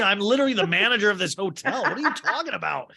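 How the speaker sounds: high-pitched incredulous protest, laughing; comic indignation. Acted. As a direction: protest as an offended hotel manager. Barney indignant in an improvised retort.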